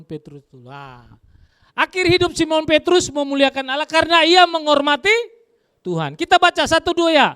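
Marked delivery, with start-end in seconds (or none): 1.8-7.3 loud, high reading voice; scripture reading. Acted. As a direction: read the verse out boldly.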